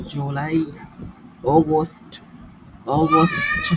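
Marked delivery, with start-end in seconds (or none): none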